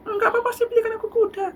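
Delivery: put-on high, fast stubborn kid; high childish insistence; insistent child. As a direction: insist like a child who won't be refused.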